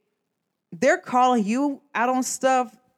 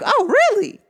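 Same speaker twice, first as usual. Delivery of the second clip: a much higher, louder "Oh, really?" in sarcastic disbelief; mock disbelief; 14 semitones higher, 7 dB louder than usual. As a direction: play it up as shocked and skeptical. Retold reaction to friend's excuse.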